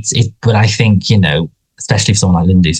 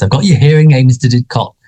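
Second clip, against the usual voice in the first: a hint of fussy, eager Colin; Colin impression; 6 semitones higher than usual. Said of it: "Colin says".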